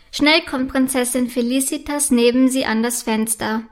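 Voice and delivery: plain reading voice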